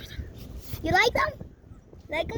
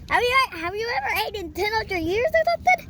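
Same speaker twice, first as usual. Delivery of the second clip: ordinary delivery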